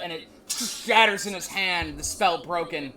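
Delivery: game master sets the scene; dramatic narration. A narrator's reading. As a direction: build the tension as he raises his hand. DM narrating the spell breaking.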